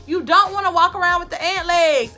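ordinary delivery

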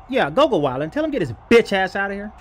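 ordinary talk, nothing marked

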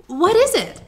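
high, loud surprised question. A narrator's reading. Dorothy asking the Queen about the charm, surprised. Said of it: "said Dorothy in surprise".

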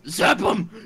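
punchy shout of the two words; shouted command. Shown as a demonstration. Trying out the Pence line for friends, shouted command. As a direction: bark it like a battle cry. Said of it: "how about I try it?".

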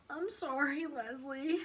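put-on small, contrite child; reluctant apology. A narrator's reading. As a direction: apologise because you were told to. Paul made to apologise.

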